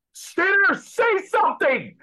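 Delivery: high, loud and slow, yelling in his face; frantic yell. Retold or imitated. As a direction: shout at a frozen old man to snap out of it. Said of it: "his handler got in his face and was like".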